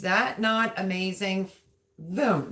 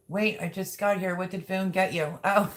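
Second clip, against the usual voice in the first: ordinary talk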